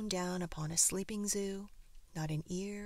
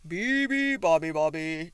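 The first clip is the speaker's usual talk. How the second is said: trumpeting elephant; 13 dB louder than usual. A narrator's reading. Elephant waking the sleeping bird, loud trumpeting. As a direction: trumpet the bird's song at full volume. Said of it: "trumpeted the elephant".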